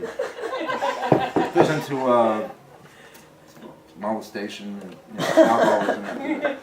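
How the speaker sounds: real laughter breaking up the words; laughing. Real, not acted. Author introducing his book's dark subjects, cracking up. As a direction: drag it out through helpless laughs.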